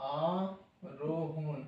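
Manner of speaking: teacher drawing out আরোহণ for pupils; slow demo word. Shown as a demonstration. Teacher repeats আরোহণ, slow and soft. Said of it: "climb মানে আরোহণ".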